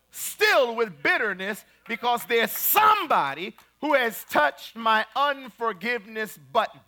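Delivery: loud, emphatic sermon delivery; full-force preaching. Real, not acted. Preacher to congregation about holding grudges, full-force preaching. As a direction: drive each point home to the congregation.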